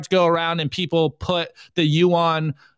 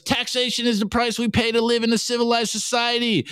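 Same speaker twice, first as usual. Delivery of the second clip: higher, put-on preachy statist; higher, sanctimonious mock voice; 6 semitones higher than usual. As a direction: recite it like a smug bureaucrat. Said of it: "the statist goes".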